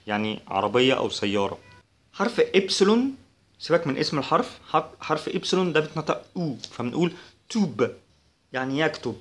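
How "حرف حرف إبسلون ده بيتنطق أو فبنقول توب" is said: normal talking voice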